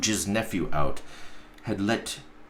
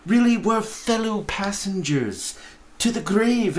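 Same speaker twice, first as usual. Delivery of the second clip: put-on higher, earnest young nephew pleading; cheerful nephew; 8 semitones higher, 4 dB louder than usual. Nephew defending Christmas to his uncle, earnest and high.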